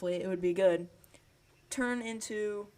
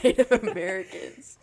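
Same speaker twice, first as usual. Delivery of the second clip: said through a laugh, louder; 2 semitones higher, 9 dB louder than usual. Real, not acted. Corrected on a term, laughing.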